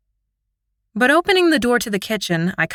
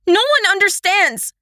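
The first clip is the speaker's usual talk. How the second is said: very high, louder tearful cry; 12 semitones higher, 3 dB louder than usual. A narrator's reading. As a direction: cry it out through tears. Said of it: "hot tears streaming down my cheeks".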